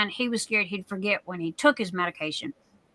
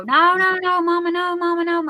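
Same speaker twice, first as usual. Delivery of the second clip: high mock child's wail of no, mama; a child fussing before a shot, high-pitched and pleading; imitating a crying child; 7 semitones higher, 4 dB louder than usual. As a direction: play the kid begging mama at the doctor's. Child resisting shots, mimicked.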